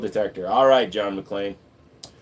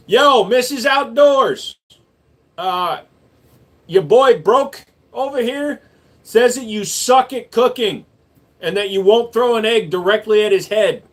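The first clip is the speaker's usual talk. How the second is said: calling out; 10 semitones higher, 6 dB louder than usual. Pretend. Streamer telling Missus Outdoors on a viewer, playful calling out. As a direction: holler for someone's attention. Calls out wife to tease viewer.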